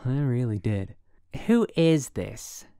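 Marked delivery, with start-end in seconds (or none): 1.5-2.6 irritated demand. A narrator's reading. Woken woman demands caller's name.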